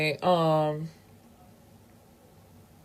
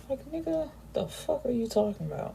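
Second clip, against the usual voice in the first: exasperated disbelief; 5 semitones higher, 7 dB quieter than usual. Real, not acted. Exasperated aside about the character.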